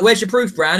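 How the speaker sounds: a high, scoffing demand; mocking challenge to Bran; mock skeptical lovers. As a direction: challenge him, half laughing. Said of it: "they'll be like".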